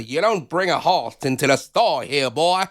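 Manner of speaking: lightly voiced Western cowboy; old cowboy drawl. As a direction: saloon cowboy talk, drawn out. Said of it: "it sounds like an old cowboy movie".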